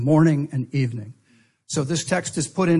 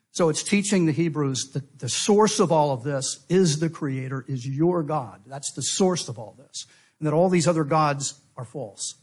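ordinary delivery

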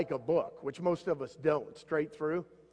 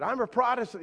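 higher call from the bridge; retold shout; 8 semitones higher, 4 dB louder than usual. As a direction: shout it up to the stranger, mock. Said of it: "The guy shouted".

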